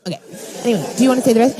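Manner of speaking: ordinary talk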